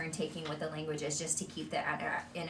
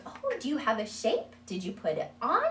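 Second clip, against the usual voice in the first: higher, sing-song run of questions; quizzing child; 5 semitones higher, 4 dB louder than usual. Shown as a demonstration. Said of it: "If we're constantly like".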